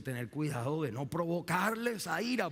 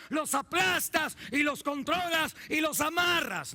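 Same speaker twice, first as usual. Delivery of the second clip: preaching shout; 12 semitones higher, 6 dB louder than usual. Real, not acted. Preacher thundering a warning at the parents, loud and high.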